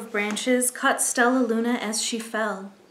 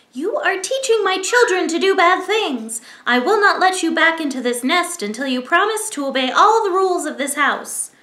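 high, loud firm scolding; mother bird lectures Stella Luna on obedience; stern mother bird; 7 semitones higher, 6 dB louder than usual. Acted. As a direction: lay down the rules of the house.

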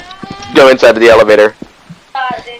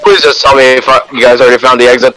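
ordinary delivery

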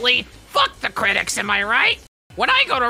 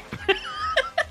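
high squealing laugh; 5 dB quieter than usual. Real, not acted. Burst of laughter after miss.

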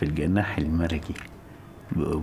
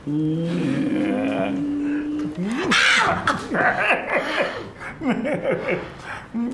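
long high-pitched gloating laugh; evil high laugh. Acted. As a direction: cackle in triumph. Husband's scheme succeeded.

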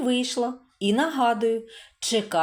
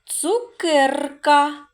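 slow syllable reading; 3 semitones higher, 4 dB louder, much slower than usual. Shown as a demonstration. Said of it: "Теж позначаємо однією рисочкою".

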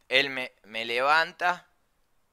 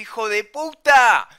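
high, loud, drawn-out furious curse; angry shout; 8 semitones higher, 10 dB louder than usual. Retold or imitated. Friend scared by fake shark attack, angry shout. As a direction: yell the insult, stretching it out.

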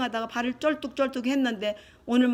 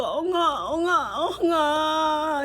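drawn-out, slightly raised wail for big brother; longing call; 4 semitones higher, much slower than usual. Acted. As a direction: call out for a missed brother, in mock sorrow. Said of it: "이제 엉아가 이제 생각나는 거야". (형을 그리워하며 길게 부르는 소리)

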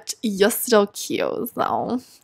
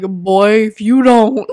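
a hint of a stern mom; lightly voiced warning mother; mock scolding mom; 5 dB louder, slower than usual. Mom scolding her son, lightly mocked. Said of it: "his mom's like".

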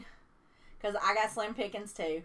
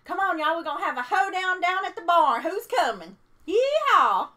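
loud, high hammed-up country holler; rally the bar with a mock yeehaw; playing up a rowdy country twang; country hoedown caller; 6 semitones higher, 10 dB louder than usual. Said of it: "I sound like I need to be saying".

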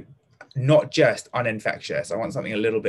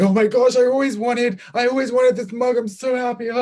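squealed much higher and louder, over the top; hysterical joy; 12 semitones higher, 7 dB louder than usual. Shown as a demonstration. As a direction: gush wildly over a present. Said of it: "you might be hysterical".

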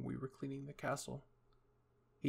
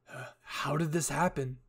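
usual speaking voice

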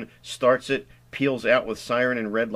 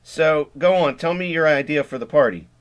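strained voice; 5 semitones higher, 6 dB louder than usual. Acted. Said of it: "in a strained voice".

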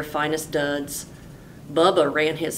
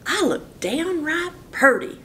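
much higher, amazed delight; delighted Bubba; 11 semitones higher than usual. Acted. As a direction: marvel at his new duds. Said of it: "said Bubba".

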